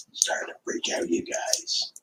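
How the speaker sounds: hushed admission of freaking out; hushed confession. Real, not acted. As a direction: whisper it to the viewers. Confides he is freaking out.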